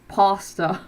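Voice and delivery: pasta said as "pahsta", open ah vowel; pasta with broad ah. Shown as a demonstration. Testing pasta as "pahsta" for the viewers. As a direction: try pasta with a long "ah". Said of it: "pasta or pos".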